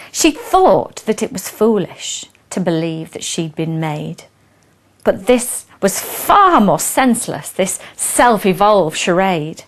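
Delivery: expressive read-aloud of a rhyming poem; storyteller reading verse to an audience; poem recitation. Acted. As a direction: recite it with relish, line by line.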